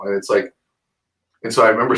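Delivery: normal talking voice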